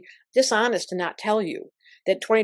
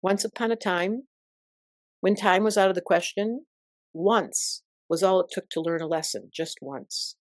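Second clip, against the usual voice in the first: poem recital. Acted. An astrologer recites the poem she wrote at seventeen. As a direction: recite it like a remembered verse. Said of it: "I did memorize some of the childhood poetry".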